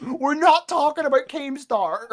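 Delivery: high, loud and slow; playful shout. Real, not acted. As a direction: yell it to shut the topic down. Refusing topic, yelling playfully.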